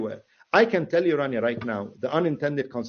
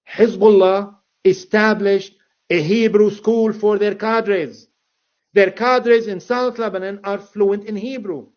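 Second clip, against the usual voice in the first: slow, loud and weighty; emphatic; 4 semitones higher, 7 dB louder, slower than usual. Real, not acted. Guest stressing Hezbollah's skill, slow loud emphasis. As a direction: spell it out slowly and firmly.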